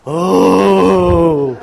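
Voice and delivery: long, sustained teasing "ooh"; drawn-out mock "ooh". Preacher reacting to the horoscope question, mock ominous. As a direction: hold an exaggerated ooh of intrigue.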